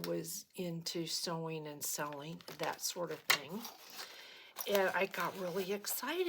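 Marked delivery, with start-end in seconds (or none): none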